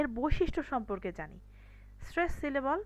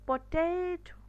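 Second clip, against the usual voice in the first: Potato with a long, higher TE; lengthened middle syllable; 5 semitones higher, much slower than usual. Shown as a demonstration. Teacher giving an example of longer duration, middle syllable stretched. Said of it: "টেটাকে কিন্তু একটু লম্বা করে বলেছি".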